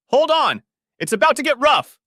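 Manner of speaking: very high, loud yell; shouted warning. Acted. Kevin warns his passengers before the bumpy ride, shouting. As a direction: brace them with a full shout.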